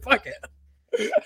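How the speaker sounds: mild laughing surrender; laughing give-up. Retold or imitated. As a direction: throw up your hands laughing. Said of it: "I finally said".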